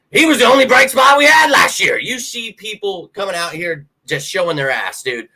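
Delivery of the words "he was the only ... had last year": much higher and louder, real outrage; indignant shout. Real, not acted. Fan defending Hurts against critics, shouting in outrage. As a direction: yell it in his defence.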